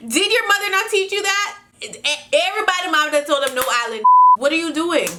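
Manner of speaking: high-pitched, incredulous telling-off; exasperated scolding. Real, not acted. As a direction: scold in disbelief with the pitch pushed up. Scolding the letter writer for dating him.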